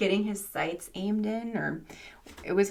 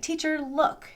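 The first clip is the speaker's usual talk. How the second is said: high, eager mock child's call; imitating excited pupil; 9 semitones higher, slower than usual. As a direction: lightly act a kid excited to show off. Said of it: "he was so excited".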